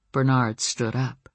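ordinary delivery

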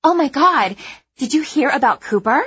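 high, loud, gossipy thrill; bursting to share gossip; gleeful excitement. Acted. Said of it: "a look of pure glee".

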